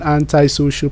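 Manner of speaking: "antisocial" said as AN-tie-social; American pronunciation. Shown as a demonstration. Someone warning about Joy as an example, American "AN-tie" in "antisocial". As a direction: give "antisocial" an "eye" sound after "ant". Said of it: "an example on the American pronunciation".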